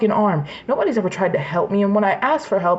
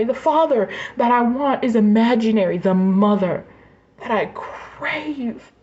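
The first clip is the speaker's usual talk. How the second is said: pitched higher, straining as the words fall on "imaginary"; holding back tears, each line cracking upward; voice breaking with grief; 6 semitones higher than usual. Real, not acted. Ranting about family she wishes she had, voice breaking.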